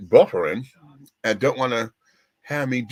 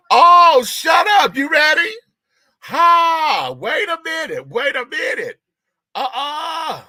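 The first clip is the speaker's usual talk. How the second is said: much higher and louder, squealing with delight; excited squeal; 10 dB louder, slower than usual. Real, not acted. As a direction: shriek it out in excited disbelief. Excited outburst greeting a guest.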